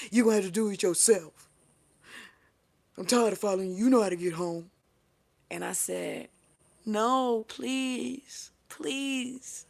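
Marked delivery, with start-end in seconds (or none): 0.1-4.7 loud, firm retold refusal; fed-up scolding. 6.9-9.7 high, drawn-out pleading; begging. Retold or imitated. Retells own begging.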